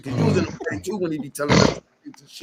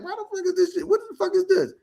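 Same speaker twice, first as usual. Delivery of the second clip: much higher, baffled quote; incredulous; faster than usual. Retold or imitated. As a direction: squeak it out in disbelief. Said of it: "I'm like".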